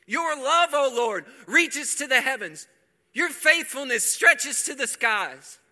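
high, loud, fervent proclamation; preaching at full force. Acted. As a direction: drive the verse home to the crowd. Preacher repeats scripture, shouting.

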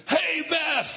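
loud call across the house; yelling. Retold or imitated. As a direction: shout her name from the next room. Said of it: "Sorry for yelling so loud".

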